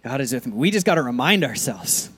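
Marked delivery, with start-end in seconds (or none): 0.0-0.5 soft repeated affirmation. Shown as a demonstration. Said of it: "Say it again".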